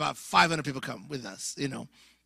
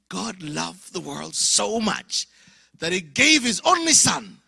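slow, louder sermon line; drawn-out, rising preacher voice; preaching; 4 semitones higher, 9 dB louder, slower than usual. Retold or imitated. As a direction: proclaim it slowly like a preacher. Preaching John 3:16.

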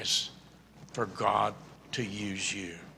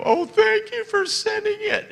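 very high, louder thankful voice; grateful gush; 8 dB louder than usual. Retold or imitated. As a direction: squeal your thanks like an overjoyed caller. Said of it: "they go".

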